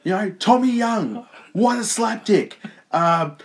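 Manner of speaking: very high, slow, loud put-on voice; mock commentator. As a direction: ham up the commentator name-calling. Impersonating the commentator.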